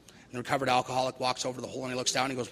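ordinary delivery

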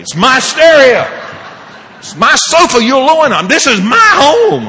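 high, very loud, stretched-out claims; angry possessive shout. Retold or imitated. Father taking back control of his house, possessive shouting. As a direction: bellow each claim of ownership, hammed up.

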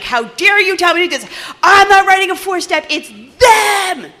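high, loud whiny mock protest; mock outraged newcomer. Mocking the newcomer's outraged whine.

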